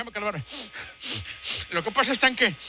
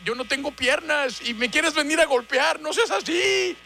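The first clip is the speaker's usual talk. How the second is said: whiny pleading; 6 semitones higher than usual. Acted. Father pleads, playing the victim.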